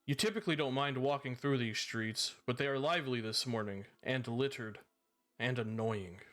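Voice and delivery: measured storyteller scene setting; game master narration. A narrator's reading. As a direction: paint the scene for the players.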